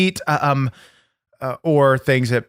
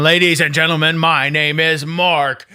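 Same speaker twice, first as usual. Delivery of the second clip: wrestler promo; 4 semitones higher than usual. Acted. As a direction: announce yourself like a champ.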